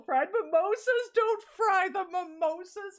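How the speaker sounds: high-pitched comic protest; mock outrage. Protesting at the list of fried foods, mock outrage. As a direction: jump up high, playfully appalled.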